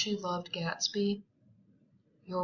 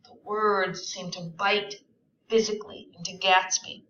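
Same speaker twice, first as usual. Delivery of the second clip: ordinary talk, nothing marked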